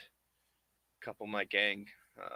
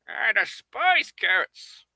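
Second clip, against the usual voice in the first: higher, louder, slow seagull grumble; a disgusted gull spitting out its verdict; 9 semitones higher, 9 dB louder, slower than usual. Acted. The seagull character rejecting carrots, loud, high and slow.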